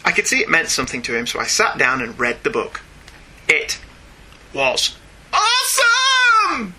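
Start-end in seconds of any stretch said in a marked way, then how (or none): 3.5-6.7 high, slow, stretched-out hero voice; over-the-top animated hero announcing awesome; bad Incredibles impression. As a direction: ham up a cartoon superhero. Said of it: "That was me trying to do The Incredibles".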